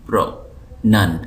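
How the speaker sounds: प्रोनाउंसिएशन split as pro - nan - siye - shan; a beat of silence between each piece of प्रोनाउंसिएशन; syllable by syllable. Shown as a demonstration. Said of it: "ऐसा टुकड़ा जिसको हम एक second में अदा कर सकें".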